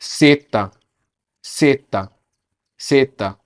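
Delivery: सेता as Spanish "zeta"; Spanish letter name. Shown as a demonstration. Teacher to students, careful Spanish letter name. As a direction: model सेता clearly. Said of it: "Z को कैसे कहते हैं".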